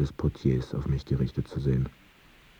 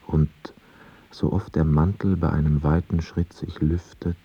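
normal talking voice

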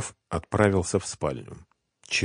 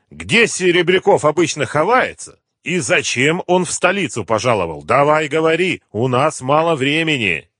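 raised, loud, demanding questions; pressing interrogation; 9 semitones higher, 6 dB louder than usual. A narrator's reading. Gangster demanding Серебряков's hideout from captive, pressing. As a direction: press him hard for an answer.

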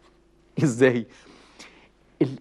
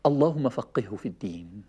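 tender prayer in the ear; 9 semitones lower, 4 dB quieter than usual. Retold or imitated. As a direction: pray it gently and close. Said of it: "يقول له إيه في ودنه".

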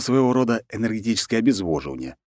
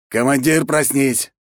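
raised voice to wake his commander; loud call; 7 semitones higher, 4 dB louder, slower than usual. Acted. Wolf calls loudly to wake the sleeping commander, raised voice. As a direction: call out loudly, a bit irritated. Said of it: "громко сказал волк".

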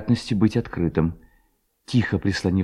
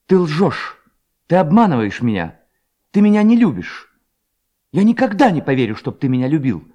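higher, louder narrated reproach; indignant accusation; 5 semitones higher, 6 dB louder than usual. A narrator's reading. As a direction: throw the accusations out, raised and hurt. Hanna indignantly rejects the stranger's words. (возмущённый упрёк повышенным голосом)

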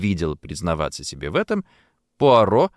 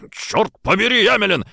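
higher, pained growling oath; growled curse; 11 semitones higher, 3 dB louder than usual. A narrator's reading. Colonel bites on glass and growls at his wife. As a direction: bark it at your wife. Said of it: "прорычал он".

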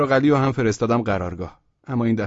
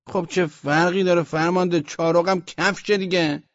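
higher, protesting reply; arguing back; 7 semitones higher than usual. A narrator's reading. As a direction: argue back at your commander. Soldier argues back at shouting commander. (جر و بحث با فرمانده)

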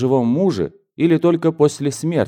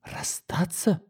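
high, hushed, airy question; thin, high whispery cry of shock; shocked exclamation; 8 semitones higher, 11 dB quieter, slower than usual. A narrator's reading. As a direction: gasp it out softly in disbelief. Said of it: "снова воскликнула она".

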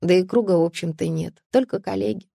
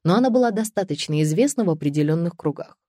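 plain reading voice

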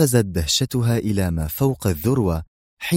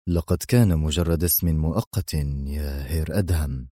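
low, haughty villain voice; arrogant villain; 6 semitones lower, 4 dB quieter than usual. A narrator's reading. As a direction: brush it off with lofty disdain. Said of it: "وقال وهو يلوح بكفه في غطرسة". (نبرة شرير متغطرس منخفضة)

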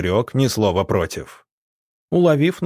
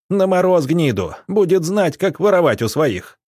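higher-pitched angry call to a mob; angry agitation; 8 semitones higher than usual. A narrator's reading. Alexei urging the crowd to banish a thief, angry. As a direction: egg the crowd on, pitched up and indignant.

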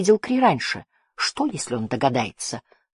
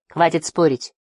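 usual speaking voice

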